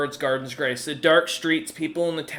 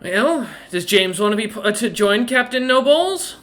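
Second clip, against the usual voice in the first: higher, louder rising question; lilting playful threat, climbing to the end; menacingly teasing woman; 6 semitones higher, 4 dB louder than usual. Acted.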